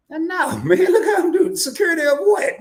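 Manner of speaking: mock-offended, laughing through it; laughing denial.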